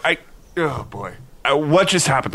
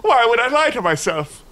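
high, wailing question; hammed-up despair; anguished knight; 10 semitones higher, 3 dB louder than usual. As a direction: lament your own self-deception. Knight anguished at self-deception.

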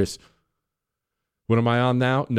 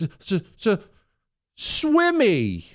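high, stammering mock sob of a little boy; mock fake sobbing kid; 9 semitones higher, much slower than usual. Son answering his dad, stammering through mock sobs. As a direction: imitate a kid faking tears. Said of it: "fake sobbing".

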